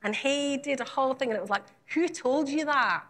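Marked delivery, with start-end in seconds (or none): 1.9-3.0 slow attempt at a Scottish accent. Youth leader preaching on insecurity, attempted Scottish accent. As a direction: have a go at a light Scottish accent. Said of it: "I'm gonna try a Scottish accent now".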